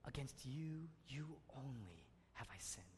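hushed, fading to a whisper; whispered verse. Shown as a demonstration. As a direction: breathe it out as a broken confession. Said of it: "place an emphasis on each word".

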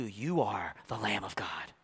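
soft unvoiced whisper, slow. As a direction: breathe it like a secret. Father whispering to Jesus.

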